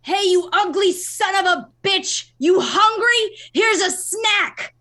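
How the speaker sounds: high, very loud taunting yell; full-voiced taunt to a monster. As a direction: bellow a challenge down into the pit. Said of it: "I'm gonna yell down".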